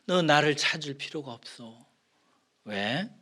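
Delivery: ordinary talk, nothing marked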